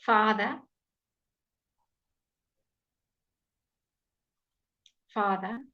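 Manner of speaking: father with a long open ah; clear father. Shown as a demonstration. Teacher modelling father for class. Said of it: "teaching you very clear pronunciation".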